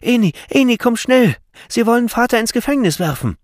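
put-on high, loud child's plea; frantic high-pitched kid calling out; agitated little boy. A narrator's reading. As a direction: cry urgently to grandfather. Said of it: "Aufgeregt lief er ihm entgegen".

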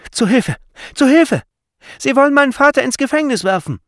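high, loud, panicked cry; shrieked alarm, rushing at the end; child's scream for help. A narrator's reading. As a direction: yell for rescue like a frightened boy. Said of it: "schrie er".

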